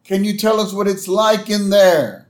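high, sustained calling voice; Doctor Hibbert. Retold or imitated. Host as doctor calling to lost Homer, calling out. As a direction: project it as if to someone far away.

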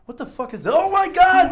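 high, loud shocked yell rising to a shriek; startled shout. Retold or imitated. Rider on the oily highway, retelling his shocked yell. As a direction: blurt it out as the bike starts sliding. Sees oil dripping, bike fishtails.

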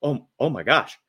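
mock shocked broadcaster, rising at the end; stunned Tony Romo. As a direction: react like a stunned commentator. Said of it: "hear Tony Romo go".

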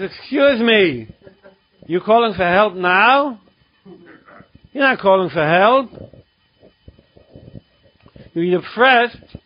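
slow, deliberate sponsor rebuke; slow scolding. Retold or imitated. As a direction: drag it out to drive the point home.

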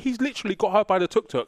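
ordinary delivery

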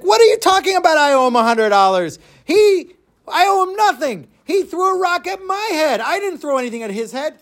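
very high, squawking disbelief; outraged protest. Acted. Eliezer protests the judge's verdict, outraged and incredulous. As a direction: protest an absurd ruling in a high, incredulous cry.

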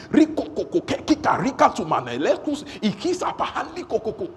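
fervent tongues prayer. Shown as a demonstration. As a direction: pray in tongues like something is happening. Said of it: "sometimes it sounds like something's happening".

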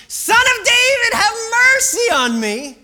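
very high, full-volume desperate scream. Acted. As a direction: scream the plea at the top of your lungs. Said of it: "screaming at the top of his lungs".

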